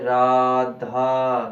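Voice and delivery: Radha drawn out very slow and loud, calling across a distance; calling out far. Shown as a demonstration. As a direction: call Radha to someone far away, stretching the last aa. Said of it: "Radha के बाद लंबा ले गए". (दूर खड़े व्यक्ति को लंबा पुकारना)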